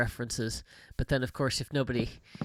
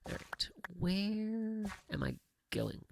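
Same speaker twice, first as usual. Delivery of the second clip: whisper; 7 semitones higher, 8 dB quieter, much slower than usual. Real, not acted. Lost in the map, musing.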